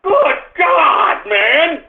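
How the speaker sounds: exasperated shout. Real, not acted. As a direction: throw the words up in frustration.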